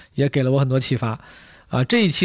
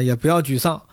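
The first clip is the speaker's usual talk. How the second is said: normal talking voice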